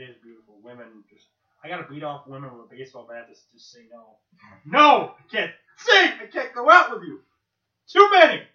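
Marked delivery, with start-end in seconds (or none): 4.6-8.4 loud, high, playful yelling; mock shouting. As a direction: shout them off in jest. Mock turning women away.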